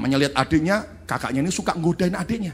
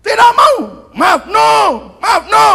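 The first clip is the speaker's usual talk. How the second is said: high, loud screaming refusal; child's tantrum; 12 dB louder than usual. Retold or imitated. Little daughter screaming she won't apologise. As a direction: scream no like a toddler.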